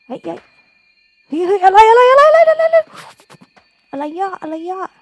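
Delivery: high, loud, rapid panicked what-what-what; panicked shout. Real, not acted. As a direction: scream in sudden alarm. Sudden threat in game. (ตะโกนตกใจสุดขีด)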